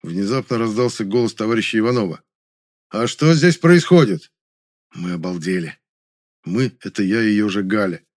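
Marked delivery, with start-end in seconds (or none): 2.9-4.3 loud, high, drawn-out challenge; stern demand. A narrator's reading. Comrade bursting in on the group, stern demand. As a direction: demand an explanation, slowly and loudly. Ivanov suddenly confronts the group.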